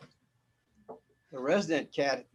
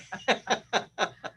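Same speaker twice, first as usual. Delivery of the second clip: high burst of laughter; 9 semitones higher, 4 dB louder than usual. Real, not acted. Laughing at own cat impression.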